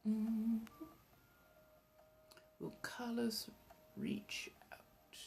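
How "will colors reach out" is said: voiceless murmur to oneself; whispered reading. Real, not acted. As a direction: read it under your breath, barely audible. Reads the question under her breath.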